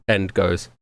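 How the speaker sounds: ordinary talk, nothing marked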